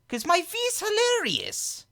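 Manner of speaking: very high, drawn-out caricature; V impression. Shown as a demonstration. Impressionist defending his impression to a critic, high V voice. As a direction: boast in an exaggerated high, stretched voice. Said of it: "better V voice".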